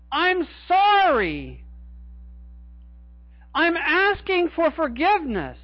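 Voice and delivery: a preacher voicing a repentant Judas's plea; pleading Judas. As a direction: beg for forgiveness as remorseful Judas.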